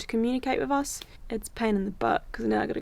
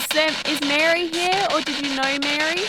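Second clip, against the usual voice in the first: talking to a ghost; 5 semitones higher, 5 dB louder than usual. Real, not acted. Asking if Mary is here.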